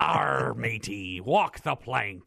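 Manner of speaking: slow hammy pirate drawl; pirate talk. Pretend. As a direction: ham up the pirate lingo slowly. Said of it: "Good pirate talk there".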